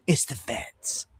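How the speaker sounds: slow, hushed whisper; conspiratorial whisper. Real, not acted. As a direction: lean in and breathe it like a secret. Conspiratorial aside about feds.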